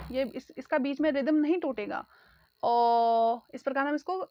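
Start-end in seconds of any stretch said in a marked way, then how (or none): 2.6-3.5 drawn-out अ held steady without a break; sustained vowel demo; long held a. Shown as a demonstration. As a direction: hold the vowel अ as one long unbroken sound. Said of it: "आप इसको continuously बोल सकते हैं".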